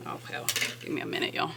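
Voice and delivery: huffing and puffing, out of breath; breathless, annoyed sigh through the words; irritated huff. Real, not acted. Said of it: "Sorry for the huffing and puffing".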